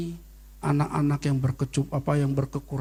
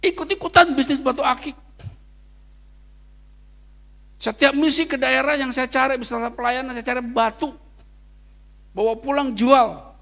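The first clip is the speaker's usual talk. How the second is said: raised, high preaching voice; 8 semitones higher, 8 dB louder than usual. Acted. Pastor preaching his testimony to the church, raised and high. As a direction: preach it out to the congregation.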